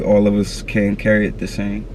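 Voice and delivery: ordinary delivery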